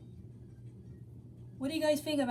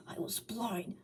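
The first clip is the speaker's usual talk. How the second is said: whisper; 6 dB quieter, slower than usual. A narrator's reading. Sonic confessing to Hog.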